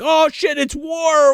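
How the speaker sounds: high mock alarm; hammed-up startled militia shout; alarmed cry. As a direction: raise the alarm in a retold panic.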